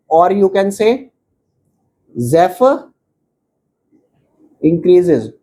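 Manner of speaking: every word spelled out slowly for students to copy; slow dictation. Acted. Teacher dictating the definition to students, very slow. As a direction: dictate it word by word so they can write.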